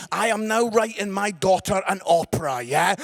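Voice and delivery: ordinary talk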